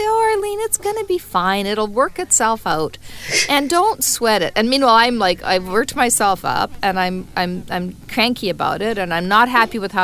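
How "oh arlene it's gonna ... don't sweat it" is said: much higher, breezy reassuring tone; a high, soothing imitation of a calm friend; soothing impression. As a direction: float it up lightly to calm a friend. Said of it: "You always just say".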